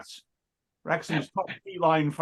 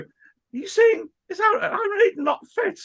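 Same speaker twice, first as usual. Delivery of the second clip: horrified cry. Retold or imitated. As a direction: wail it out in horror. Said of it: "I was so horrified".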